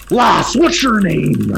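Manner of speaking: loud, slow announcer voice; announcer asking. Acted. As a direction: call out to the fighter over the crowd. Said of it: "the announcer's just like".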